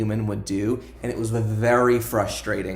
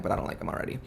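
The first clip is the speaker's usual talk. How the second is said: whispered aside; 4 semitones lower, 8 dB quieter, much faster than usual. Real, not acted. Aside about disliking character.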